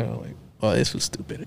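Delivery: soft, half-whispered scorn; sneering hushed aside. Retold or imitated. People from school quietly sneering at his plans, soft whispered scorn. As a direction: whisper a disdainful put-down. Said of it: "They were like".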